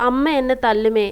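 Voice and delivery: ordinary delivery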